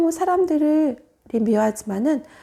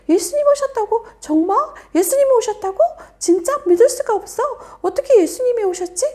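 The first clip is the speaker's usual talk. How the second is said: excited crowd; 8 semitones higher, 3 dB louder than usual. Acted. Crowd excited Jesus has come.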